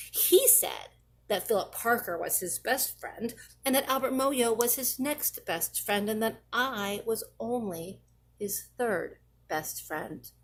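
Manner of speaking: ordinary delivery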